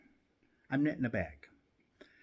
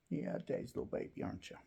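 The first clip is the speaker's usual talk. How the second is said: soft, quick whisper to the kitten; 4 semitones higher, 9 dB quieter, much faster than usual. Real, not acted. Owner whispers baby talk to her kitten.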